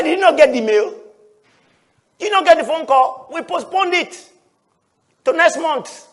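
a hint of a pastor chuckling at him; amused pastor on phone.

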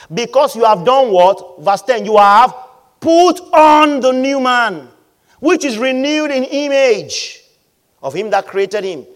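loud, forceful sermon delivery; preaching at full force. Acted. Preacher exhorting the church about attention, loud and forceful. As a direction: drive the point home to the congregation.

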